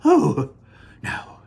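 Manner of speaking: high, loud, urgent Wonka voice; frantic showman shooing kids from camera; Mister Wonka. Acted. Wonka warns Mike Teavee back from the camera, high and loud.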